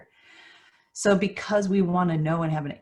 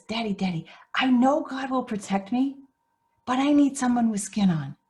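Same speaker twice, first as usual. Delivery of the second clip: put-on high, louder child's plea; earnest little boy; 8 semitones higher, 4 dB louder than usual. Boy insisting to his dad he needs someone there, high earnest plea. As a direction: plead sincerely like a small child.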